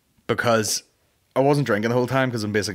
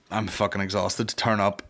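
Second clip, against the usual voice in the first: ordinary talk, nothing marked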